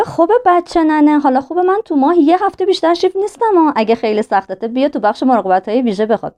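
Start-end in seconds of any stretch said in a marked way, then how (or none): 0.0-3.7 wife teasing her husband in a put-on laughing voice; teasing changed voice. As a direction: tease him like a pampered kid. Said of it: "با خنده و تغییر صدا گفت".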